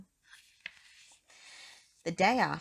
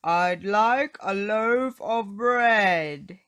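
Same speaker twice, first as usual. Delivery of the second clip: slow, loud booming voice; big voice demanding bread; 4 semitones higher, 10 dB louder, much slower than usual. Acted. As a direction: boom the order out slowly. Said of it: "he boomed".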